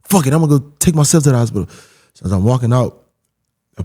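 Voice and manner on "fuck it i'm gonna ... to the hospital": fast, high, loud retold outburst; indignant raised voice, pitched up and hurried; getting mad. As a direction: re-enact fed-up anger, words rushing out. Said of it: "I get mad".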